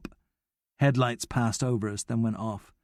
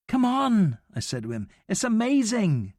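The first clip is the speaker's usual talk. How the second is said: excited; 9 semitones higher than usual. A narrator's reading. Thrilled boy atop a fence.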